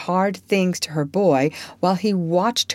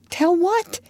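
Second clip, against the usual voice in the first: startled question; 14 semitones higher than usual. Acted. Jo puzzled by his outburst.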